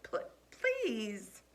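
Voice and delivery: a broken, choked please; voice breaking. A narrator's reading. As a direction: let the word crack in your throat. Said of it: "The words began to break up in my throat".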